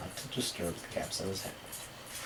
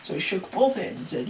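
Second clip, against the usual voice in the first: plain reading voice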